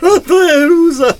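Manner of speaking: high, laughing exclamation; laughing reaction. Real, not acted. Host cracking up at his partner's joke, high and laughing. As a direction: burst out with it through laughter.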